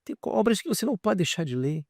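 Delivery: ordinary talk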